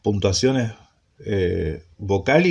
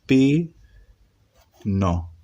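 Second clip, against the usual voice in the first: syllable by syllable. Shown as a demonstration. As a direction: sound out pi and no separately. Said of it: "¿Qué dice acá?". (pi, no en sílabas separadas, con pausa)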